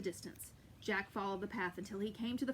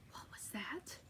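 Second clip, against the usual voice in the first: hushed alarm; 11 dB quieter, slower than usual. Acted. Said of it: "cried the giant".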